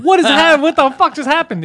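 high, loud incredulous outburst; losing his mind. Retold or imitated. Patient reliving the nurse's exam, losing his mind. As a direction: shout it like you've lost it. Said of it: "I lost my mind. I was like".

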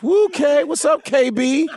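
high, loud hype-man greeting; a pumped-up friend shouting hello; hyped greeting. Retold or imitated. [name] voiced greeting loudly.

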